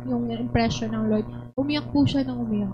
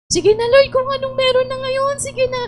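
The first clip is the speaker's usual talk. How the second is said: much higher, louder, hammed-up giving in; mock churchgoer telling God she'll settle; mock resigned plea; 11 semitones higher, 7 dB louder than usual. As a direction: overplay someone settling for less.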